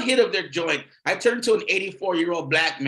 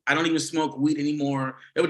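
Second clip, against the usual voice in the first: normal talking voice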